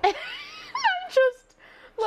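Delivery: squealing with laughter, very high; laughing hard. Real, not acted. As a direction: crack up helplessly.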